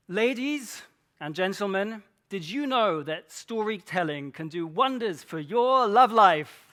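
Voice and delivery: grand address building to a loud finish; theatrical hook, swelling to a big, loud payoff; showy talk opener. Shown as a demonstration. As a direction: open like a showman, punching the final words loud. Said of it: "start a talk like this".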